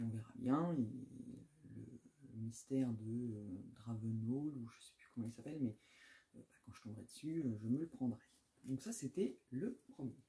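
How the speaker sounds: normal talking voice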